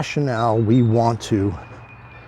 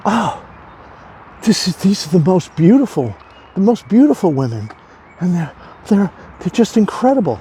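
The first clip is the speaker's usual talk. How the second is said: much higher, breathless with rapture; ecstatic gushing; 7 semitones higher than usual. Acted. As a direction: gush like he can't believe his eyes. Man raving about butterfly card.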